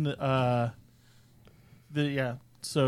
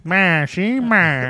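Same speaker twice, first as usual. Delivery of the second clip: put-on loud Boston-Rhode Island accent; New Yorker with a cigar, mock Boston; 4 semitones higher, 4 dB louder, much slower than usual. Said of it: "a Boston, Rhode Island accent".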